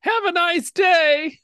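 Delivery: squeaky high slow farewell; a tiny sarcastic goodbye; slow squeaky send-off; tiny Minotaur. Acted.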